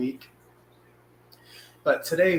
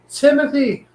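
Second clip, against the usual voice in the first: excited greeting; 12 semitones higher, 10 dB louder than usual. Real, not acted. Greeting a viewer by name.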